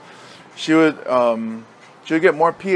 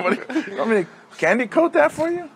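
sarcastic jab; 10 semitones higher, faster than usual. Retold or imitated. Coach sarcastic to kid.

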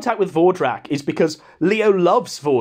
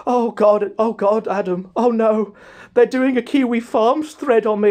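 panicky Leo impression; 4 semitones higher than usual. Panicked Leo voiced on call.

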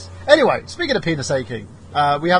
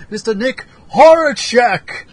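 higher, louder, slow emcee call; announcer intro; 8 semitones higher, 8 dB louder, slower than usual. Acted. Host introduces the guest with a big emcee call. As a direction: bellow the guest's name like a ring announcer.

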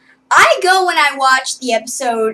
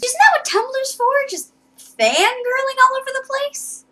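excited; 11 semitones higher than usual. Real, not acted. Rhetorical gushing question.

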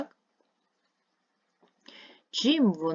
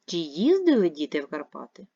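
їздили said louder and stronger; stress on їздили; 5 dB louder than usual. Shown as a demonstration. Teacher models a yes-no question, stressing їздили. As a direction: press on їздили. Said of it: "наголошуючи на одне, е, слово".

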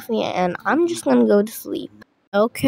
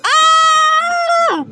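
very high, long pretend scream; terrified scream; 4 dB louder than usual. Kid screams at the attacking zombie, terrified scream. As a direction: let out one long, high shriek.

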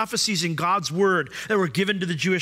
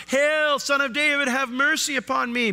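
crying out; 9 semitones higher than usual. Retold or imitated. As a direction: call out desperately to be heard. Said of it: "she's crying out".

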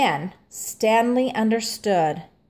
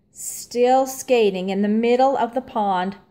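usual speaking voice

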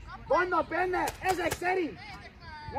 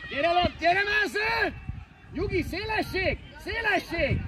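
high, raised sideline yell; shouted orders; 4 semitones higher, 3 dB louder, slower than usual. Real, not acted. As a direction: urge players on from the touchline. Coach shouting orders during play. (hangos edzői bekiabálás)

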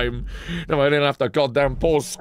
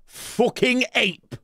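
slow scornful jab, higher; drawn-out insult; 8 semitones higher, much slower than usual. Real, not acted. Streamer insulting a chatter, slow scornful jab. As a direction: sneer it slowly.

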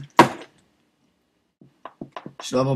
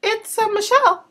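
put-on high girly voice; 14 semitones higher, slower than usual. Prank caller's high voice.

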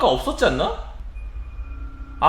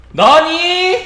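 loud high puzzled question; surprised; 11 semitones higher, 9 dB louder, much slower than usual. Real, not acted. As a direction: blurt it out in surprise. Surprised, uses Japanese. (깜짝 놀란 물음)

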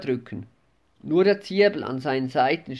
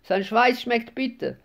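wailing; 8 semitones higher than usual. A narrator's reading. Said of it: "manche jammerten".

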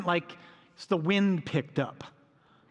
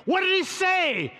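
much higher and louder, shouting over the storm; yelled question; 13 semitones higher, 4 dB louder, slower than usual. Retold or imitated. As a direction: call out across the boat. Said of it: "he's like".

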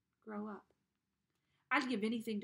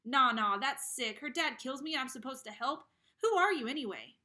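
loud, rising anger; angry protest; 4 semitones higher, 8 dB louder than usual. Acted. Boy angrily protests to Ghost Boy. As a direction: fling it back indignantly.

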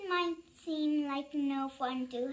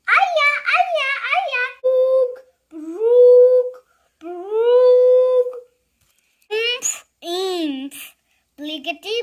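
silly sounds; 2 semitones higher, 12 dB louder than usual. Acted. Reader forced to make silly sounds, goofy and loud. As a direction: belt out nonsense words wildly. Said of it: "make silly sounds like".

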